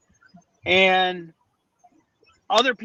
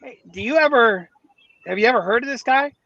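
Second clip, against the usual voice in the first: eager question; 5 semitones higher, 5 dB louder than usual. Retold or imitated. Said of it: "talking to his wife and goes".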